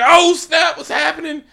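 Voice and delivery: very high excited gasp; hammed-up surprise; mock shocked crowd. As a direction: react like the crowd is stunned.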